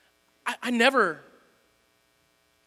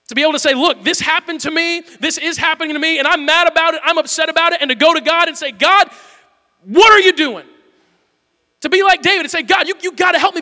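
loud, higher impassioned cry to God; full-force preaching; 7 semitones higher, 7 dB louder than usual. Real, not acted. Pastor voices a desperate prayer to God, shouting. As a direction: climb into a shout of the plea.

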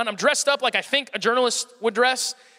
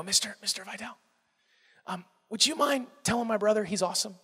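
soft, sheepish and halting; hushed, starstruck politeness, acted out; timid request; 2 semitones lower, 8 dB quieter than usual. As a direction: ask your idol a favor meekly. Retold sheepish request to his idol.

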